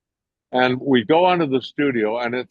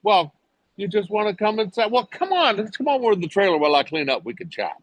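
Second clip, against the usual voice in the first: much higher, sweet and fast; Kelly inviting her; 9 semitones higher, faster than usual. Retold or imitated. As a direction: chirp the invitation like a friendly star. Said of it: "and she goes".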